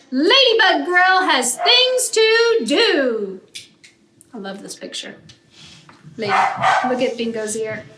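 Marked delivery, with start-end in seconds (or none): 0.1-3.4 high, loud, slow heroic declaration; proud little hero. Acted. Lulu telling Bingo about her mission, slow proud announcement. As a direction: declare it like a busy superhero. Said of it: "she says".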